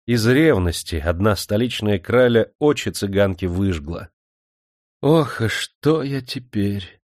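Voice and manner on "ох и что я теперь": higher, slow sorrowful lament; woeful, drawn-out sigh of self-pity; pained moan. A narrator's reading. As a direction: moan it out, stretching the words. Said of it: "вырвался болезненный стон".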